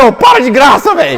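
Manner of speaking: mildly heated, irritated telling-off; retold nervous scolding. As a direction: act out losing patience with him. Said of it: "eu fiquei nervoso". (bronca nervosa, encenada)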